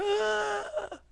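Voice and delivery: thin high-pitched mock scream; long high "ah" of fake screaming. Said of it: "when they're just like".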